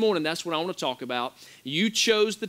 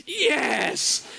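much higher triumphant shout; excited yes; 8 semitones higher than usual. Retold or imitated. Danielle cheering as quarters fall, shouting. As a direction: cheer like you just hit the jackpot. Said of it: "She's freaking out".

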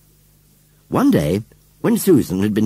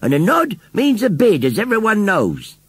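high, emphatic explaining; insistent postman; 11 semitones higher, 3 dB louder than usual. A narrator's reading. Postman explains the bid to Missus Kibbins, insistent. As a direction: lay down the rule to her. Postman argues with old lady.

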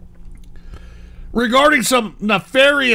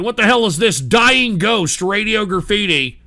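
usual speaking voice

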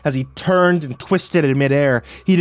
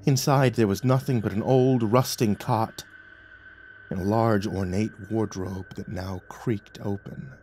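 usual speaking voice

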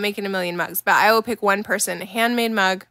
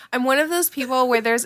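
ordinary talk, nothing marked

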